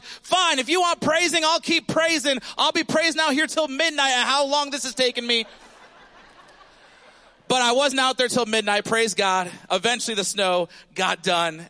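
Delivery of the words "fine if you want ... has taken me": high, exasperated grumbling; sarcastic mock huff. Pastor retelling his huffy reply to his wife, high and sarcastic. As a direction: fire it back, fed up and sarcastic. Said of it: "I'm like".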